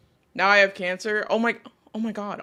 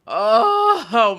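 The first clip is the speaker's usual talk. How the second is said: pained groan; 4 semitones higher, 10 dB louder than usual. Real, not acted. Throat hurts.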